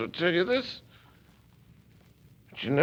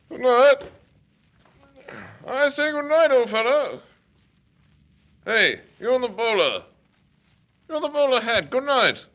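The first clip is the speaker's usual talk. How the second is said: loud, higher tipsy call across the street; drunken calling out; 7 semitones higher, 5 dB louder than usual. Acted. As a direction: hail the man in the bowler, a bit drunk.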